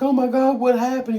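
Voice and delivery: high, loud mock alarm; panicked bystanders. Vlogger voicing coworkers after the gunshot, high loud mock alarm. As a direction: play coworkers shocked by a gunshot. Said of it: "They're like".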